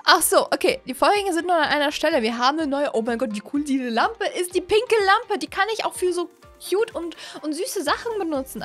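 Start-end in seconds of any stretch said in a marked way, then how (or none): none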